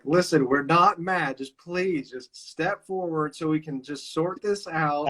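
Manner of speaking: high, slow pleading in an overdone soothing tone; mock calm-down plea. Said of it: "It's like".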